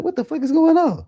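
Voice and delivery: very high, loud panicked cry of "What the fuck is going on?"; shocked shriek. Retold or imitated. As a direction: squeal it out as if the seizure just hit. Suddenly seizing on the ground.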